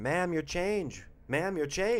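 imitated cashier calling after a customer, high and drawn out.

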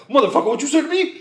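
fast, high, laughing mock indignation; mock outraged plant. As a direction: snap back like a offended tough guy. Offended plant voiced in mockery.

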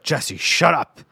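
mock exasperated yell; urgent hushing. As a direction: tell a panicking friend off. Telling panicked coworker to shut up.

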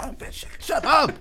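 high-pitched comic comeback; mock exasperation. Man retorting to girlfriend who ruins his cool, mock exasperated. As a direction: act out an embarrassed, exasperated shut-down.

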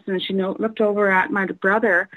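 ordinary talk, nothing marked

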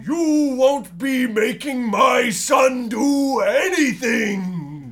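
a slow booming father's interruption; stern dad entrance. Acted. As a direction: boom it out as the arriving dad. Father bursts in.

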